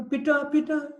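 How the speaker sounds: high-pitched imitated call for father; lightly acted scared little boy; frightened child. Small boy clings to father in a storm, mock frightened. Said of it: "he gets so frightened".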